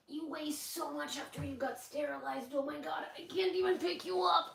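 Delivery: high, soft pet-talk voice. Real, not acted. Owner tries to pick up the heavy dog, soft high baby talk. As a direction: coo high at a pet too heavy to lift. Talking to the dog while lifting it.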